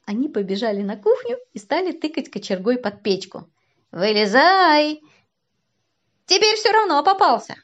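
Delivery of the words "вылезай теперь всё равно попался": громко и высоко, торжествуя; крикни спрятавшемуся, что он попался; азартный крик. A narrator's reading. Кричат, тыча кочергой под печку. (торжествующий крик)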